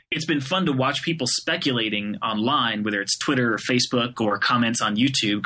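usual speaking voice

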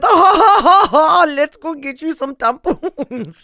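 high, drawn-out mock French accent, laughing; crappy French accent. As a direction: tease loudly in a badly faked French accent. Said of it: "That was my really crappy French accent".

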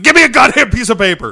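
high, loud, fast demand; impatient shout. Retold or imitated. Host voicing Deke demanding paper, fast loud shout. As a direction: bark it out impatiently. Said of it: "he's like".

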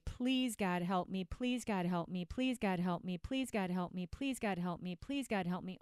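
rote, repetitive mock prayer; praying dieter. Dieter praying instead of acting, mock rote prayer. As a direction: chant the plea in an identical falling tune. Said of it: "I'm going to pray".

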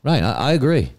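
put-on British accent. British voice bit.

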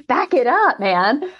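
high, amused back it up; patient telling the rushing clinician to slow down; mock pushback.

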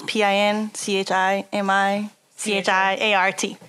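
slow, letter by letter; spelling it out. Shown as a demonstration. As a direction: spell each letter slowly and clearly. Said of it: "Just how it sounds".

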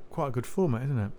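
ordinary talk, nothing marked

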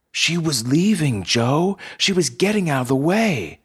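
high, loud, upset objection; distressed protest. Acted. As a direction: cry out in protest at the shooter. Protesting after a shooting.

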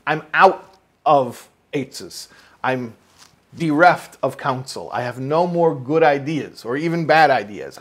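fervent sermon delivery; impassioned preaching. Real, not acted. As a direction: drive each point home to the congregation. Teacher preaches the verse's meaning passionately.

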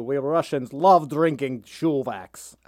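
slow, heavy mock Russian accent; deadpan Russian joke. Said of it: "you can do a Russian accent".

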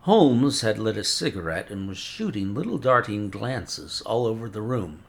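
plain reading voice

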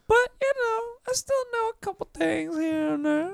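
high, squeaky mock modesty; silly put-on voice. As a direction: put on a comic high-pitched voice. Joking boast in silly voice.

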